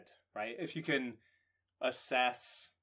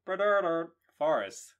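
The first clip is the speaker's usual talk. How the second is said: Barnalar tooted like a trumpet, higher and louder; trumpet imitation; 5 semitones higher, 6 dB louder than usual. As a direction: blare the name out as a mock trumpet call. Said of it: "the sound of a trumpet".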